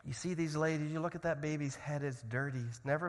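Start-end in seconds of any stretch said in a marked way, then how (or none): none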